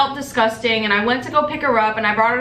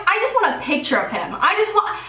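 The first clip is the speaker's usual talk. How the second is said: ordinary talk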